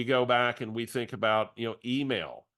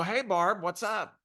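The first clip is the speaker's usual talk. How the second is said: much higher cheery hello; pitched-up friendly hello; overeager greeting; 10 semitones higher than usual. Retold or imitated. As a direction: greet her like an old friend. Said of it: "Don't judge me for answering like I do".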